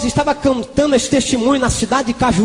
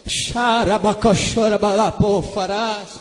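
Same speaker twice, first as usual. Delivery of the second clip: slow, drawn-out glossolalia; speaking in tongues; 3 semitones lower, 4 dB quieter, slower than usual. Real, not acted. Preacher praying in tongues during the sermon, speaking in tongues. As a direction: draw the prayer syllables out slowly.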